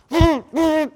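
high, loud imitated tool whirr; imitated garage machine noise. Preacher mimicking the clanging garage, loud high noises.

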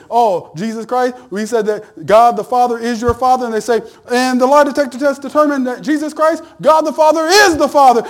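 hammed-up Maury reveal; mock talk show host. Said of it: "it would be like Maury Povich".

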